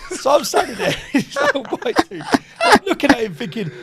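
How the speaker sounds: high, loud, helplessly amused; laughing. Real, not acted. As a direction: keep telling it while laughing hard. Laughing over a retold prank.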